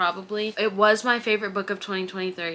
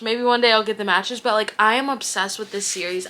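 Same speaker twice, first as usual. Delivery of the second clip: ordinary talk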